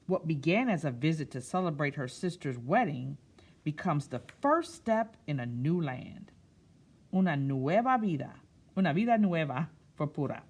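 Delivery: picture-book narration; storytime reading. A narrator's reading. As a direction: read it to a room of children. Reads picture book aloud.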